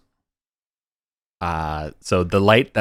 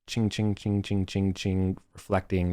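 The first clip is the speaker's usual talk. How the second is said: quick, soft repeated chings; light bouncing sound effect, lightly voiced; imitated ricochet sound; 4 dB quieter, slower than usual. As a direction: mimic light pinging from mirror to mirror. Imitates light bouncing between mirrors.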